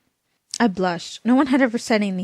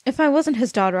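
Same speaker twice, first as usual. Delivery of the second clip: usual speaking voice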